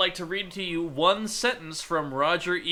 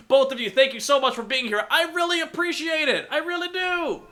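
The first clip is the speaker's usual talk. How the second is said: gushing thanks; 10 semitones higher than usual. Real, not acted. Host's effusive farewell thanks.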